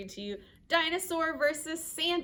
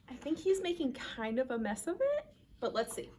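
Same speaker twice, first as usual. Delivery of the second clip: usual speaking voice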